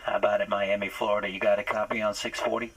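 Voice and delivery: ordinary talk, nothing marked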